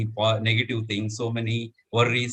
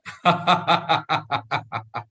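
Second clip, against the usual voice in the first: hearty demonstration laugh; demo laugh; 3 semitones higher than usual. Shown as a demonstration. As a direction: belly laugh on cue. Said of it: "I will show you how to do that".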